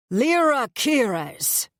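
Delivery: slow, slurred, bellowing high call; drunken yell. A narrator's reading. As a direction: yell her name drunk and dragged out. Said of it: "a voice yells, a drunken, slurred voice".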